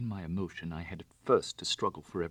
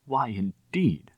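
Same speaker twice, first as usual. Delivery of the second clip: slow, louder, bitter echo; 5 semitones higher, 7 dB louder, slower than usual. Acted. Bunny bitterly echoing Raffles's question. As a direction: throw his words back slowly. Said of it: "I echoed".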